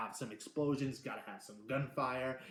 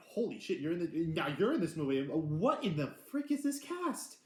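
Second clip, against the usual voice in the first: amazed exclamation; 5 semitones higher, 4 dB louder than usual. Retold or imitated. Amazed at the star cast.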